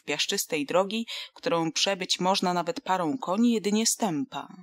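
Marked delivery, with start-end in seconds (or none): none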